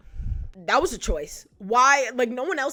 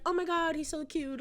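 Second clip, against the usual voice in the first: higher, softer swoony gush; breathless crush gushing; swooning girl; 7 semitones higher, 5 dB quieter than usual. Retold or imitated. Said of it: "She's like".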